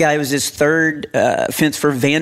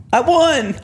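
chirpy, pitched up boast; pleased high brag; proud boast; 11 semitones higher than usual. Retold or imitated. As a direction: say it like you won a race. Son's proud retort.

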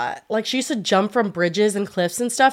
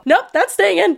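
teasing; 11 semitones higher, 4 dB louder than usual. Real, not acted. Teasing reply to a cut request.

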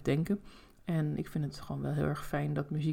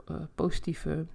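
ordinary delivery

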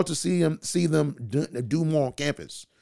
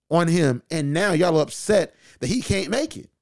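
normal talking voice

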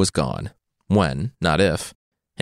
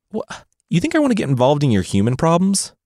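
sarcastic cat voice; 7 semitones higher than usual. Acted.

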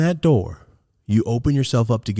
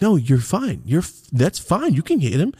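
higher, insistent mom voice; emphatic reassurance; 9 semitones higher, 3 dB louder than usual. Retold or imitated. Mom telling son he's fine, emphatic reassurance. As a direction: reassure firmly with swooping, raised pitch.

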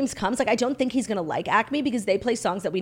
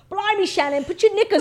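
high-pitched mock English scolding; put-on English accent; 11 semitones higher, 3 dB louder than usual. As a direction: imitate a scandalized Brit, English accent. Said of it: "like, an English accent".

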